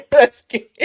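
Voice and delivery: high, loud laugh through words; laughing before getting started. Real, not acted. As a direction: burst out laughing mid-line. Laughing burst.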